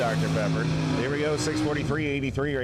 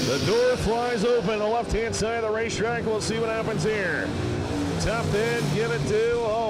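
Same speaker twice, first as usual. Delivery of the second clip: excited call; 10 semitones higher than usual. Real, not acted. Door flies open mid-race.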